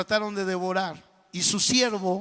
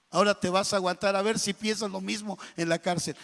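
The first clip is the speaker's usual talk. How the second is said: normal talking voice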